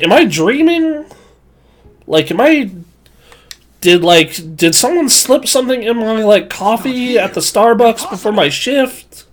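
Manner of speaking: high, stunned disbelief; can't believe what you're hearing. Retold or imitated. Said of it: "I'm like".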